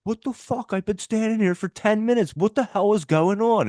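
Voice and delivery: high, mock furious rant; high-pitched mock outburst; retold angry yell. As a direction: imitate an irate customer fed up with the line. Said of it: "this guy's yelling".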